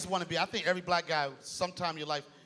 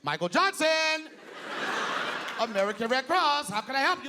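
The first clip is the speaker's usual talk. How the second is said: higher corporate America phone voice; 6 semitones higher than usual. Retold or imitated. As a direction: answer like a corporate receptionist, higher. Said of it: "his corporate America voice".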